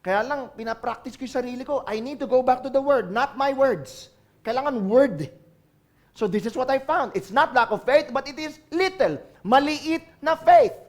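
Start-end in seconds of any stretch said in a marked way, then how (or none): none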